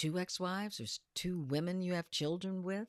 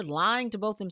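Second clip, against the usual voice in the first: ordinary delivery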